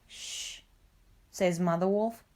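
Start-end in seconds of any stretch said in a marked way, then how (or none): 0.1-0.6 soft, voiceless shushing sound; soft hushing. A narrator's reading. Mother Wolf hushes Father Wolf, soft shush. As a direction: hush them gently.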